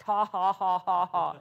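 deliberate, spelled-out belly laugh, falling in pitch; retold laughter, sliding downward; imitated laugh. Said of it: "I just laughed".

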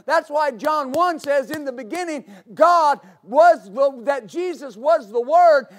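loud, driving sermon climax; preaching at full force. Real, not acted.

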